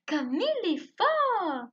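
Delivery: loud, high, slow cry of admiration; explosive exclamation. Shown as a demonstration. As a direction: lead the class in an explosive exclamation. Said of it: "un peu explosif".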